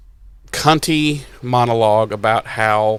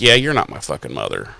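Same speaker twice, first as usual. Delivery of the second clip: much lower, quick and unbothered; cool dismissive Wood; 12 semitones lower, 6 dB quieter, faster than usual. Retold or imitated. Principal Wood dismissing the First, low and cool. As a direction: drop low and brush it off fast.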